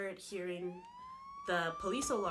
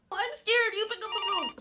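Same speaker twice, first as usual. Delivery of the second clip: scared whine; 12 semitones higher, 6 dB louder than usual. Retold or imitated. Sisters arguing who answers phone.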